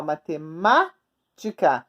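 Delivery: "matemática" slow, held on "MÁ", then a gap before "tica"; stressed syllable. Shown as a demonstration. Teacher showing pupils the accent, "matemática" slowly split at "má". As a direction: stretch the accented "má" in "matemática" and pause after it. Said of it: "ele tem um acento no ma".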